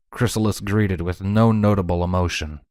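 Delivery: ordinary delivery, nothing marked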